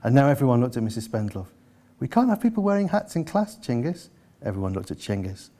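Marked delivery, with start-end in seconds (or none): 2.0-4.1 high-pitched teacherly protest; raised pitch, dropping on the boy's name; objecting teacher. A narrator's reading.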